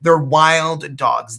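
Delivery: slow and loud, each word pressed; emphatic. Real, not acted. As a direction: drive the point home slowly. Insisting the dogs are wild.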